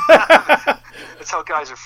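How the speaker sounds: laughing it out, slow and loud; cracking up through the words. Real, not acted. Laughing after friend's teasing text.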